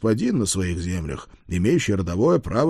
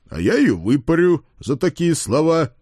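raised, higher mock fury; blustering threat; 7 semitones higher, 4 dB louder than usual. Father threatens to thrash his daughter, blustering. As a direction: threaten with heavy, indignant emphasis.

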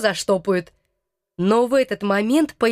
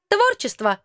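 high creature voice; 9 semitones higher than usual. Acted. Krokotuk answers.

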